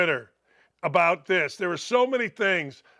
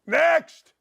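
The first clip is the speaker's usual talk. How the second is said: higher, louder shout of Next; loud call; 7 semitones higher, 5 dB louder than usual. Real, not acted. Host calling the next joke, loud shout.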